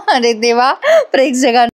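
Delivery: usual speaking voice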